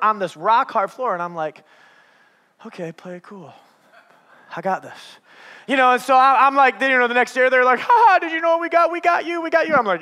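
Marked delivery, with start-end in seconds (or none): 2.7-5.2 muttered pep talk to himself, much softer; self-talk under breath. Retold or imitated. Pastor coaching himself on floor. 7.8-9.7 higher, fast mocking kid taunt. Kids taunting the pastor.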